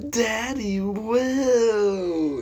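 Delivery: high, long-drawn holler down a hallway; loud taunting yell. Retold or imitated. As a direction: imitate a kid yelling as loud as he can. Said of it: "yells that as loud as he can".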